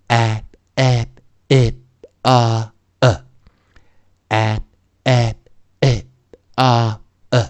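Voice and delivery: "A, E, I, O, U" as short a, eh, ih, ah, uh; short vowels. Shown as a demonstration. Teacher drills the five vowels, each short and separate. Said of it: "我们固定把这个元音去发出短元音".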